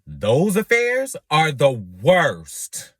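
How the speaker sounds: loud, drawn-out stress on worst; emphatic complaint. Acted. Drag queen bemoaning hopeless affairs, emphatic complaint. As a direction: hammer out each word slowly, loudly.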